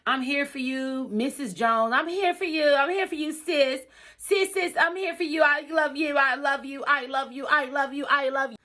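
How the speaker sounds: high, gushing mock sweetness; mimicking fake friend. Imitating a friend who kept saying she's there, gushing. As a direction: lay the fake love on thick. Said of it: "Keep saying".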